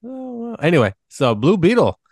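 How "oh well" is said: squeaky falsetto shrug; high mocking voice. Sarcastic high voice.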